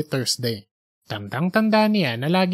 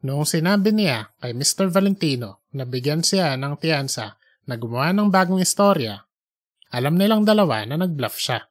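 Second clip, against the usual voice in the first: usual speaking voice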